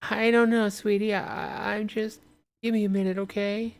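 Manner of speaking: high woman's voice, trailing off; calm her son in a high voice; soothing mother. Acted. Mother reassuring her son outside, high voice. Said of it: "Woman outside is like".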